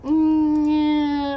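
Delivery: high, loud reluctant whine; mock reluctance. Patient mimics doctor avoiding the procedure, high reluctant noise. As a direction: imitate someone who doesn't want to do it. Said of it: "like, didn't wanna do it".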